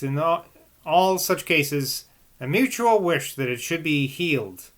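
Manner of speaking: put-on measured, even reading of an old text; calm literary reading voice; reading aloud. A narrator's reading. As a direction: read it like a steady audiobook narrator.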